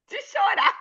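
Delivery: high, slow, half laughing half crying; laughing through tears. Real, not acted. Remembering comforting the crying pastor, laughing till tearful. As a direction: giggle it out almost in tears.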